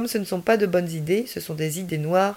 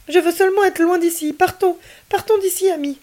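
put-on a scared boy urging his friend to run from the frozen police; urgent child; 12 semitones higher, 6 dB louder than usual. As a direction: beg to flee before they wake. Frightened boy begging to leave.

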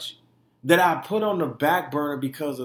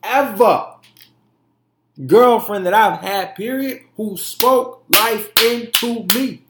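real hype, raised voice hammering the praise; hyping her up; 6 semitones higher, 8 dB louder than usual. Real, not acted. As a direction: punch each word high and proud. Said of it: "let me hype her up real quick".

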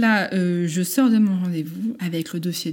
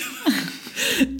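laugh; 12 semitones higher than usual. Real, not acted. Laughing-crying after confessing pregnancy.

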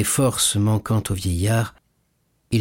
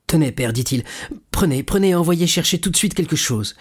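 higher, insistent delivery; eager urging; 7 semitones higher than usual. Acted. Son urging his father to take the money, eagerly. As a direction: press it on him eagerly.

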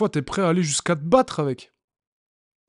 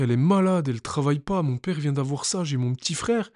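ordinary delivery

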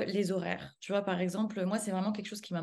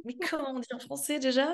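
lightly hammed-up girl who forgot her French; a hint of a show-off who acts like French slipped her mind; mock pretentious expat; 5 semitones higher than usual. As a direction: tease the fake-forgetful type with a light mock voice. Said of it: "je veux pas faire cette fille genre".

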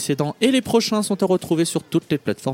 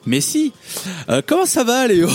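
colleagues' feigned surprise, rising high; playful astonished greeting; mock surprise; 5 semitones higher, 4 dB louder than usual. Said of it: "La réaction de mes collègues fut sans appel".

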